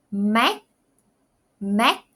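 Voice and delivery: "Mac," ending clipped with a k sound; final consonant demo. Shown as a demonstration. Teacher showing Southern ending, clear. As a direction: model "Mac," with a hard k ending. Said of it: "we pronounce it into ending C".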